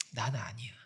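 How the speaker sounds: put-on breathy whisper of a young man who feels worthless; whispered self-doubt. Preacher voicing the young man's inner doubt, whispered. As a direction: whisper it like a secret shame. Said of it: "이런 게 있는 거예요".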